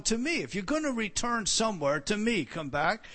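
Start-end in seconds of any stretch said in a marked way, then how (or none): 0.0-0.4 "to me." lifted high with a sharp push on "me"; single out "to me." with a high, emphatic lift; emphasis on to me. Shown as a demonstration. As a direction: spotlight "to me." as the key words. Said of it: "the emphasis upon".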